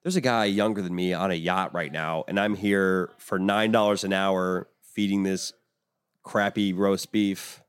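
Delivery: bitter worker. Retold or imitated. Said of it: "being like".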